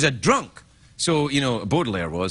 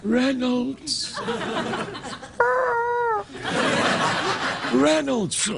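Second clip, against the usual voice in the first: dying Poe muttering; 12 semitones higher, much slower than usual. Retold or imitated. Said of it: "He would just go".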